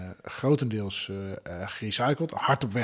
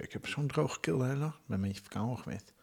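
ordinary delivery

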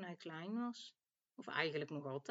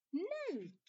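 high-pitched cry of pure delight; gleeful loud call; 12 semitones higher than usual. A narrator's reading. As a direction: call it out high, for sheer pleasure. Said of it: "Hij roept nog eens heel hard".

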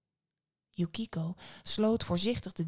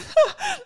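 very high, loud laugh; sudden high dismissive laugh; old woman's laugh; 10 dB louder than usual. A narrator's reading.

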